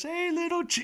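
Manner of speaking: very high cooing voice; mock baby talk. [name] cooing at the baby while snapping his hook.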